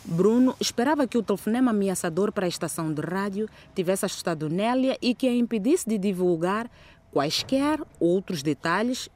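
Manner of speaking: ordinary delivery, nothing marked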